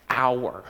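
Our. said slow and alone; stress on our. Shown as a demonstration. Preacher isolating the first word, Our drawn out. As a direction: lean on Our, drawn out and low. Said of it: "how the very first word starts".

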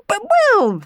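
high, loud bluster while hedging. A narrator's reading. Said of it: "hedged Don Magnifico".

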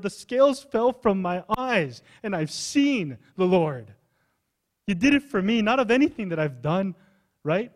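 pleading prayer. Pretend. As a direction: beg God out loud for them. Paul's imagined pleading prayer.

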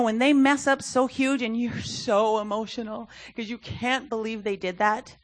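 ordinary delivery

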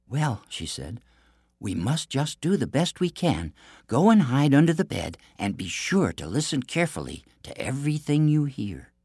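high, sweet princess voice; bright storybook princess; theme park princess. Acted. Princess plans with Ashpaddle.

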